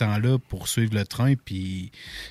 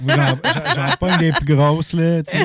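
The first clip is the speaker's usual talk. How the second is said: laughing; 6 semitones higher than usual. Real, not acted. Laughing while bragging about fish.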